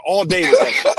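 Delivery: high-pitched emphatic exclamation; excited. Real, not acted. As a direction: shoot the voice way up in agreement. Emphatic agreement.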